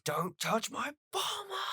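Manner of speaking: hammed-up protective growl, held back in volume; mock Vegeta outburst. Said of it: "He goes".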